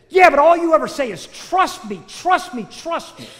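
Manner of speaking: high, exasperated outburst; heated comeback, loud then easing off in volume; angry retort. Acted. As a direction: start loud, throw back each 'trust me'. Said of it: "'Cause I was angry".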